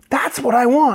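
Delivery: high, bright eager yes; client voice. Retold or imitated. Client agreeing to the stylist's haircut pitch, high and eager. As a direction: hand it over eagerly.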